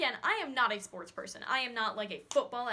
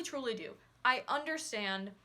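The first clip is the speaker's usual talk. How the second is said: ordinary delivery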